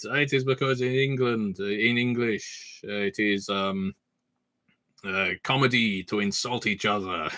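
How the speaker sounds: put-on halting French-accented explanation; earnest French guy explaining the joke. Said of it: "as you can tell from the accent".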